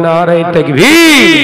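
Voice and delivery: preacher roaring to rouse the congregation; full shout. Real, not acted. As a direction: bellow তাকবীর long and loud. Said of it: "আর একটু চিল্লে বলেন".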